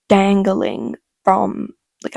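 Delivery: plain reading voice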